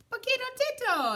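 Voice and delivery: high, loud joyful cry of a name; exclaimed. A narrator's reading. Kitty boy spotting his small friend, loud excited cry. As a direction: burst out with delight. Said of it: "exclaimed Scapito".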